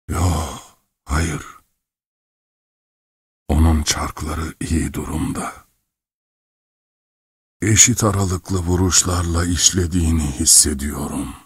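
put-on slow, halting old man's voice; dazed old clockmaker. A narrator's reading. Old clockmaker examines his soul like clockwork, dazed. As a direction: murmur it with long pauses.

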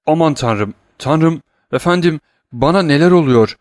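panicked cry. Acted. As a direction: cry out in terror as the fit takes hold. Servant seized by a fit. (efendisine yakaran yaşlı uşak, dehşet içinde yüksek sesle)

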